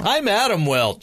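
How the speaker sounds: big high announcer intro; radio host voice. Acted. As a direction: boom out your name like a DJ. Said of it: "There he is".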